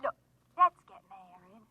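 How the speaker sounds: low, hushed seductive purr; sultry flirt. Acted. Lorene luring Frank to come over, low seductive purr. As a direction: lure him with a low, lonesome coo.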